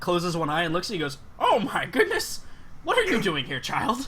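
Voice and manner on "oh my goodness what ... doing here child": much higher, louder startled cry; startled exclamation. Acted. Old seamstress suddenly noticing the halfling at her feet, a high loud startled cry. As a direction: jump at the sight of a small stranger.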